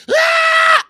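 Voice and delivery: loud, high-pitched fan scream; retold scream. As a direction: re-enact a full-out starstruck shriek. Retold scream at celebrity news.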